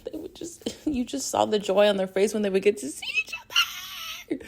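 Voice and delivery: breathy awed whisper. Real, not acted. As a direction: whisper it in awe. Hushed awe about the joy.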